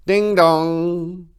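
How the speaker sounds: high, loud sung ding dong; doorbell chime. Acted. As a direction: ring it out like a doorbell. Doorbell rings in story.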